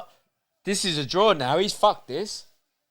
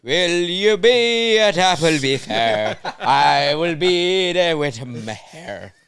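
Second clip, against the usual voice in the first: put-on slow, sing-song Irish accent; mock Irish accent, drawn out; 5 dB louder, slower than usual. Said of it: "really good Irish accent".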